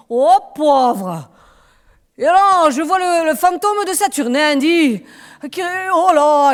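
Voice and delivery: put-on loud, high, startled drunkard; a scared drunk seeing a ghost; tipsy neighbour. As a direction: drunk neighbour at his window, frightened. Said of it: "il boit toujours un peu trop".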